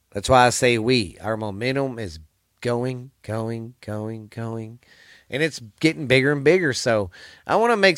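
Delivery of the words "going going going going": softer and softer, like an echo; fading echo. Pretend. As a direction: let each going fade away. Momentum line fading out.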